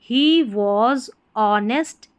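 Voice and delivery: very slow, word by word; slow reading. Shown as a demonstration. Teacher rereading the story line for students, very slow. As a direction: read it word by word, very slowly. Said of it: "reading the line again slowly".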